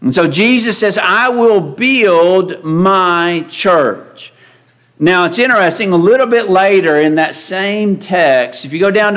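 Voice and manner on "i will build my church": slow solemn quote. Acted. Preacher quoting Jesus slowly again.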